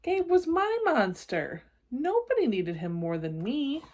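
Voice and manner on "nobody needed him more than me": usual speaking voice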